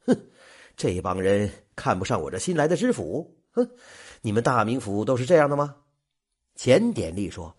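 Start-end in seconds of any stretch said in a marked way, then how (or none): none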